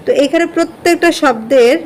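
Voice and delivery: normal talking voice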